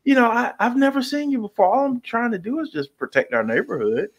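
high, fast, fake-nice entitled; mock a nosy neighbor demanding ID; Karen voice. Neighborhood Karen confronting a stranger, high fake-nice voice. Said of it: "a Karen in some ways".

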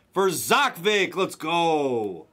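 drawn-out, fully voiced celebration; excited announcement. Real, not acted. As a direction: call out the winner slowly, triumphantly. Voiced celebration after whispered take.

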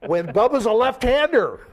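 high, loud punchline after a laugh; amused disbelief. Real, not acted. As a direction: burst out with the absurd punchline. Laughing punchline about the wrong tattoo.

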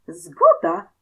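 high, loud burst of glee; exultant, high-pitched yell of agreement; delighted shout. A narrator's reading. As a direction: cry it out, overjoyed at the deal. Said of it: "wrzasnął uradowany Rokitka".

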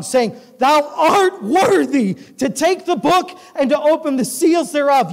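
high, loud preaching cadence; full-force scripture reading. Acted. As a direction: proclaim the verses like a revival preacher. Preacher reads Revelation at full force.

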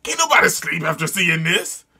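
normal talking voice

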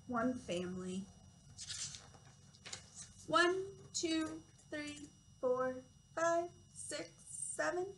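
slow, lilting read-aloud with each number drawn out; reading to children. Acted. Toddler teacher reading a counting book to children, slow storytime voice. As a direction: read it slowly and clearly for little ones.

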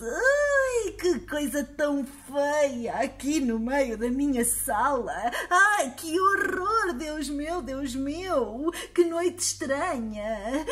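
put-on high, squealing old lady in horror; horrified old woman. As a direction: shriek like a startled granny.

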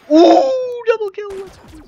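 high squeal of triumph; hyped cheer. Real, not acted. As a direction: shriek it like you just won. Celebrating a double kill.

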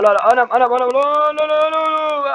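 high wailing la la la; screaming. Real, not acted. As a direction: shriek on the way down. Screaming down slide. (kaydıraktan inerken çığlık)

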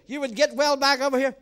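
ordinary talk

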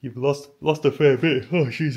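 words stretched by a yawn. Real, not acted. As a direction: talk through a yawn. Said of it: "yawning again".

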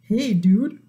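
low, laid-back fish voice; deep-pitched casual character greeting. A narrator's reading. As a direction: drop it down for a cool fish. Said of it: "And Fish says".

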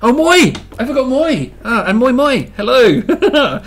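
loud, high startled "oh, moi"; suddenly remembering, loud and high; surprised realisation. Real, not acted. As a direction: catch the forgotten word and burst out loud. Realises he forgot a greeting.

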